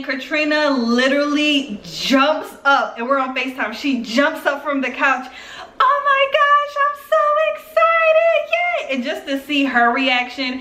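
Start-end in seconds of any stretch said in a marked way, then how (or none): 5.8-8.9 very high, drawn-out squeal; hammed-up delighted shriek, stretched out; excited friend. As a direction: imitate an overjoyed friend squealing. Coworker jumps up at pregnancy news.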